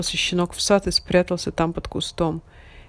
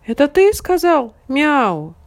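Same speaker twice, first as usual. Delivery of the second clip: puppy voice; 8 semitones higher, 6 dB louder than usual. A narrator's reading. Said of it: "спросил щенок петуха".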